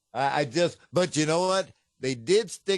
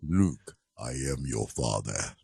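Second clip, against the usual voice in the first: Darth Vader voice; 13 semitones lower than usual. Retold or imitated. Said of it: "James Earl Jones".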